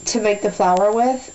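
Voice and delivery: usual speaking voice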